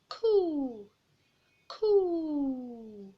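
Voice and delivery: high, loud cheerful coo; joyful birdlike cooing; happy bird cooing. Acted. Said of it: "the birds singing happily".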